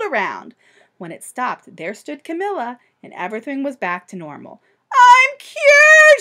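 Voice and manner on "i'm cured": much higher, loud joyful shout. Acted. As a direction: yell with relief. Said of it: "she shouted".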